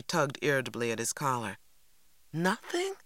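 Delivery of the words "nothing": high rising "Nothing?"; disappointed question. A narrator's reading. Girl repeats brother's news, disappointed and questioning. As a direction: echo it in disbelief, sharply rising pitch. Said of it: "I repeated, disappointed".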